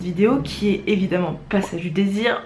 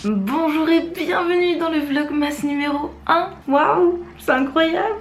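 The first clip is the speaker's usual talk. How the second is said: excited; 6 semitones higher than usual. Acted. Cheerful vlog intro.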